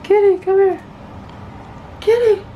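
high, sing-song call to the kitty; calling a cat. Real, not acted. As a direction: coax the cat over, up high.

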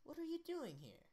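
put-on high-pitched mother in an American accent; put-on woman's voice, American accent. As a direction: ask it high, in plain American. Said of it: "just do an American".

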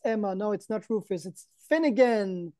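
usual speaking voice

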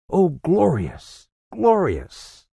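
slow, high, rapturous exclamation; exultant. A narrator's reading. Scrooge at the open window, exulting in the morning. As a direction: draw it out with soaring delight.

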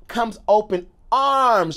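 ordinary delivery

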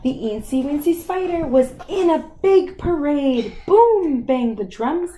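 lively rhythmic parade reading, booming on "Boom"; bouncy storytime delivery; story narration. Acted. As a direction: read the parade rhyme to a child with punch. Reads parade page to child.

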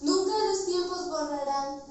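solemn, dramatic poem recitation; grand poetry declamation. Acted. Student recites poem after intro.

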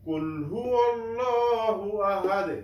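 chanted হুয়াল্লাহু with a thick L and long rounded O; heavy L. Shown as a demonstration. Teacher models the verse for learners, heavy L chanted. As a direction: draw out "law" in হুয়াল্লাহু, slow recitation. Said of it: "ল উচ্চারণ করব মোটা করে".

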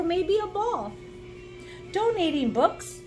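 ordinary delivery

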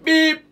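high mock censor beep; bleeped out. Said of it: "Sansür uygulayacak artık".

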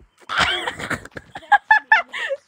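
high squeaky gremlin laugh; gremlin cackle. Pretend. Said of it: "You sounded like a gremlin".

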